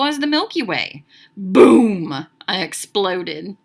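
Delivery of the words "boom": loud, booming burst; explosive boom. A narrator's reading. Alvin explodes arriving home, explosive boom. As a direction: blast the word out suddenly.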